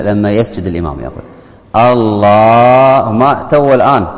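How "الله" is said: stretching the word الله very long and slow; mock imam drawn out. Shown as a demonstration. Mocking an imam who drags out الله. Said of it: "فخطأ بعض الأئمة".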